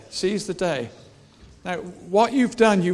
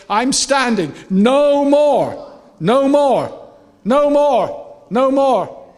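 loud, high rallying shout; defiant stand; 5 semitones higher, 7 dB louder, slower than usual. Acted. Preacher voicing believers resisting the enemy's push, defiant shout. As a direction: hammer out each declaration. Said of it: "You're saying".

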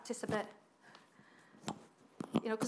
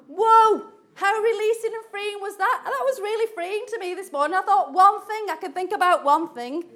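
excited; 8 semitones higher, 12 dB louder than usual. Real, not acted. Preacher thrilled by freeing thought.

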